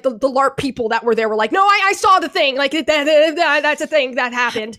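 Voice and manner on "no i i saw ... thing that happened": mock outraged larper. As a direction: play the bystanders insisting they saw it. Larpers' outraged quote retold.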